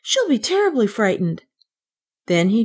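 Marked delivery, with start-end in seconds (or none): 0.0-1.4 put-on high, worried child; alarmed cry. A narrator's reading. Kay realizes Rosemarie is in danger, alarmed. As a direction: cry it out with raised pitch. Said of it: "he cried".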